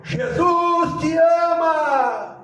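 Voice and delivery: long drawn-out, high cry; preacher's reenacted shout. Young believer shouting up an empty street at night, long drawn-out shout. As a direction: call it out to the whole street, stretching the words. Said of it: "ele grita".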